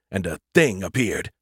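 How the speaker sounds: ordinary delivery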